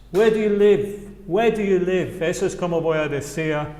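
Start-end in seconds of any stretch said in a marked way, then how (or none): 0.1-2.2 "Where do you live" run together smoothly; linked-up question; linked words. Shown as a demonstration. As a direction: join the words as one flow. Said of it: "Fija cómo también junto las palabras".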